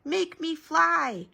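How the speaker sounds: put-on high, drawn-out kid's demand; excited stretched plea; crying out. A narrator's reading. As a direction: call it out slowly and high. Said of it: "he cried".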